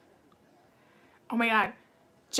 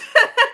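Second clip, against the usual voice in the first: high laugh; 13 semitones higher, 8 dB louder than usual. Real, not acted. Laugh after singing.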